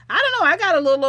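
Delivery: sudden outburst. Real, not acted. Admitting to viewers with a loud sudden squeal. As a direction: blurt it out.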